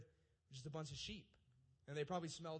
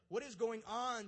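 mock panic; 5 semitones higher, 6 dB louder than usual. Terrified shepherds voiced.

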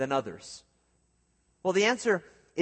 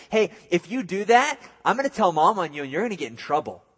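loud, fast taunting sibling; tattling kid; 4 semitones higher, 5 dB louder, faster than usual. Retold or imitated. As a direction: threaten to tell mom like a bratty kid. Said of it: "that sibling rivalry".

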